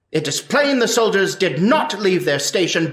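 ordinary talk

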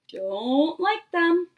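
put-on slow, loud little-kid sulk; pouty. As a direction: drag it out like a sulking kid. Child admitting dislike of timeouts.

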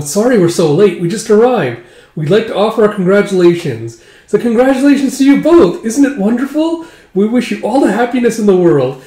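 high, gushing acted well-wishes; loud, high celebratory greeting; effusive congratulations. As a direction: gush like a late-arriving relative. Relatives congratulate the brides.

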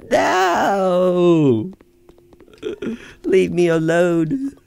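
mock plea. Mock pleading at the monster.

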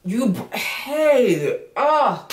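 fighting back tears as the words come out; close to tears. Real, not acted. Reading about the baby's death, real tearful voice. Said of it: "I feel like I'm about to cry".

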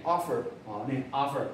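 American "offer" with an open "ah" first vowel; American model of "offer" for learners; American accent. Shown as a demonstration. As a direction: say "offer" American-style, like "AH-fer". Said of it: "美国腔就会念".

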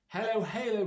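loud, high mock British "Hello, Halo"; British accent. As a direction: say "Hello, Halo" in a hammed-up British accent, with "Hello" and "Halo" both given clipped British vowels. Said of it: "British accent".

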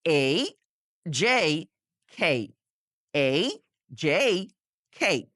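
এই, জেই, খেই drawn out one by one, each gliding into ই; pronunciation drill. Shown as a demonstration. Teacher leading learners to repeat, separate gliding এই, জেই, খেই. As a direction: model এই, জেই, খেই separately with pauses, খ aspirated. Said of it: "নিজে নিজে কয়েকবার repeat করুন".

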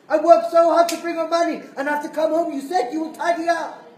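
furious wife yelling at husband; wife shouting. Retold or imitated. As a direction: scold him furiously. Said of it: "she shouts at him".

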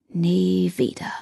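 slow whispered oath; quiet vow. A narrator's reading. Damon slowly whispering a vow never to allow this again. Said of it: "schwor Damon leise".

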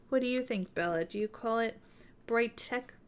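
ordinary talk

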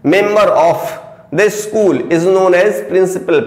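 slow, word by word dictation. Shown as a demonstration. Teacher dictates a definition to students, very slowly. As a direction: give students time to write. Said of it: "principal का मतलब".

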